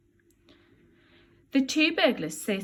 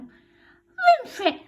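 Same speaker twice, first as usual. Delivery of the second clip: put-on baby voice; 8 dB louder, much slower than usual. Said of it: "says the baby".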